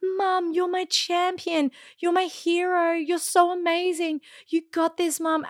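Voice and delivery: put-on a little boy telling mum she's his hero; proud son. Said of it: "Bodhi was like".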